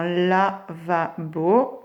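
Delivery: lavabo slowly as la, va, bo; split into syllables. Shown as a demonstration. Teacher asking pupils to find L, lavabo in pieces. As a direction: stretch each syllable of "lavabo" apart. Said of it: "Où c'est que j'entends L?".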